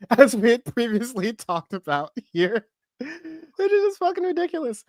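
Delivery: high, squeaky laughing aside. Real, not acted. Host finding the label ridiculous, laughing. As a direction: squeeze the words out through laughter.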